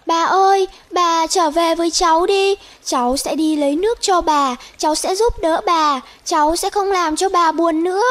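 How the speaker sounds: put-on high, loud, begging child's call; pleading call. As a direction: call out and beg her to come back. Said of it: "Tích Chu gọi". (tiếng gọi van nài tha thiết)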